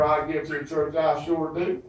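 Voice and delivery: ordinary delivery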